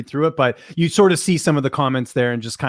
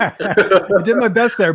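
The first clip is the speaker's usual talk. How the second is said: laughing; 6 semitones higher, 5 dB louder, much slower than usual. Real, not acted. Laughing after his impression.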